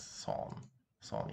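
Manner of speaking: SON as Russian "son" with an o vowel; Russian reading. Shown as a demonstration. Teacher showing the pronunciation, o vowel in SON. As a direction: say SON slowly with a clear o. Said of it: "it's pronounced as son".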